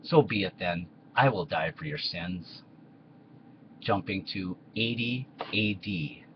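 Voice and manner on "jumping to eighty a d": slow narrator aside. Acted. Narrator aside between sketch scenes.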